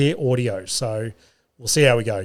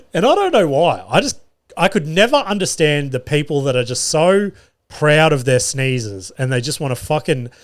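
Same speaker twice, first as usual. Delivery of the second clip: ordinary talk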